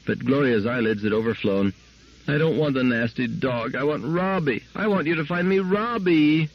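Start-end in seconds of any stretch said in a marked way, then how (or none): 2.3-6.5 put-on child starting to wail. A narrator's reading. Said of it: "spluttered into a shrill wail".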